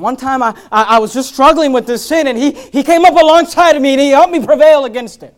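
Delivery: loud, high, driving sermon voice; loud rebuke from the pulpit; full-force preaching. Acted. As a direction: preach it hard at the room.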